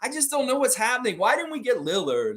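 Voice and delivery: high-pitched, overwrought whine of distress; mock despair. Host mimicking Mike Roach over the missed trade, mock despair. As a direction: imitate a fan in life-or-death panic, pitched high. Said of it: "like, life or death situation".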